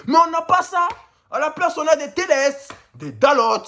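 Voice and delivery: high-pitched, indignant lament; exasperated complaint. Real, not acted. Fan bemoaning the players his team has instead, high and exasperated. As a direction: complain bitterly, voice shooting up.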